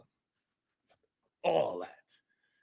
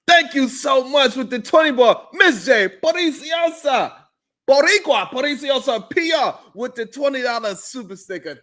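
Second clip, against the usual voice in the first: high, loud hype shoutout; excited; 11 semitones higher, 5 dB louder than usual. Real, not acted. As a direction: thank them like they just won.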